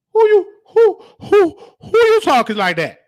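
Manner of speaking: high, loud startled call-out; incredulous shout. Real, not acted. Scolding the news lady on screen, high incredulous shout.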